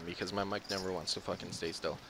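usual speaking voice

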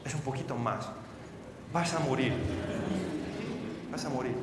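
low, hushed doctor's verdict; quiet, heavy pronouncement; grave verdict. Acted. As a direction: say it low and soft, a death sentence. Doctor announces death sentence.

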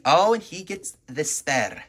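ordinary delivery

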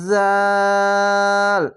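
জাল with a buzzing start and very long aa; tongue slightly out, hold জাল level; chant-like, drawn-out জাল; three-alif stretch. Shown as a demonstration. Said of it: "এটি তিন আলিফ টেনে পড়বেন".

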